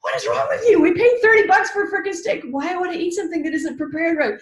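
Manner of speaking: laughing outrage over the steak; indignant inner voice. Retold or imitated. Her logical head ranting about a thirty-dollar steak, indignant. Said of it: "my logical head's like".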